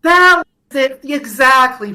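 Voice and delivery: loud, high burst of triumph; excited confirmation. Real, not acted. Host cheering his cohost's correct guess, loud and excited. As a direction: jump on the right answer.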